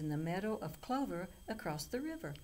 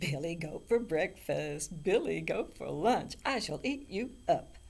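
slobbering troll; 6 dB louder than usual. A narrator's reading. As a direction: gloat over your next meal like a troll. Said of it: "slobbered the troll".

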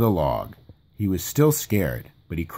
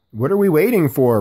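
impatient; 6 semitones higher, 6 dB louder than usual. A narrator's reading. Impatient Rabbit.

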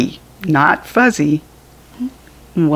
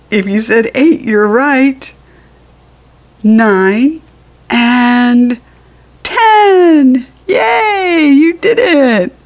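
higher, louder delighted praise; excited cheer; 6 semitones higher, 5 dB louder, slower than usual. Acted. Parent cheering the child for counting to ten, excited higher louder cheer. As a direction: cheer the kid on like they just won.

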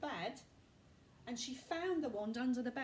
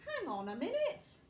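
higher, louder exclamation; Ron exclaiming; 7 semitones higher, 5 dB louder than usual. Acted. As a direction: call it out to stop your friends. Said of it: "exclaimed Ron".

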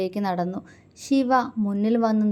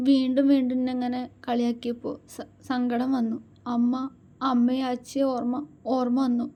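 higher, teary voice with catches; sobbing; 4 semitones higher than usual. A narrator's reading. Wife missing her parents, teary and sobbing. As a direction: speak through held-back sobs. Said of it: "അവൾ തേങ്ങിക്കൊണ്ട് പറഞ്ഞു".

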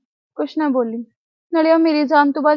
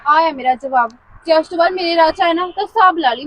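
angry warning; 7 dB louder than usual. Acted. Girl slaps him, warns.

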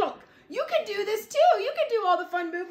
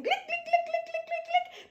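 higher, crisp click, click; high click sounds; 10 semitones higher, 4 dB quieter than usual. A narrator's reading. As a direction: tap out bright clicks. Teacher spreads icing.

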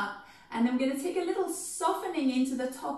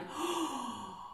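gasp; 2 semitones higher, 7 dB quieter than usual. Shown as a demonstration. Gasp of remembering good news.

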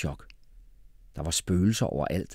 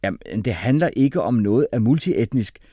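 loud, raised insistence; heated, higher-pitched objection; shouted retort; 4 semitones higher, 7 dB louder than usual. A narrator's reading. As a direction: argue back forcefully. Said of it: "råbte Jeppe".

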